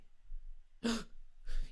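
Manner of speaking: sharp breathy gasp of discovery; mock gasp. Comedian pretending to discover a contradiction, mock gasp. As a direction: gasp at a realization.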